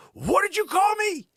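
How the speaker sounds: very high, loud outraged shriek; hammed-up piercing indignation; mock scream. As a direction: imitate a woman screaming in offence. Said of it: "And she screams at him".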